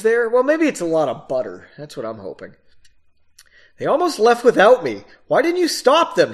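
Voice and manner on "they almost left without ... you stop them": high, loud reproachful questioning; accusing. A narrator's reading. Woman accusing her travel companion, indignant. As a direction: throw the blame at him. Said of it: "she asked in an accusing tone".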